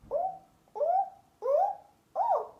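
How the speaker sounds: high, loud monkey ooh-ooh calls; cheeky monkeys hooting back at the peddler; monkey hoots. Acted. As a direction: hoot up high like teasing monkeys.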